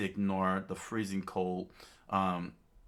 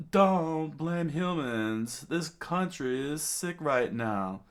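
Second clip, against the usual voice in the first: high, drawn-out plea; implore, easing down toward the end; remembered mentor's plea; 8 semitones higher, 3 dB louder, slower than usual. Acted. Doctor's words remembered in a flashback, raised pleading voice.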